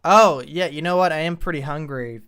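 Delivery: sleepy drive-thru employee over the speaker. Acted. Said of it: "a drive-through voice".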